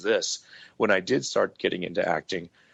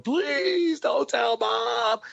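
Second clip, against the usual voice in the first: very high, slow mock-sobbing child's begging; bawling plea; much slower than usual. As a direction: beg like a crying little kid, stretched out. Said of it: "I'm bawling, going".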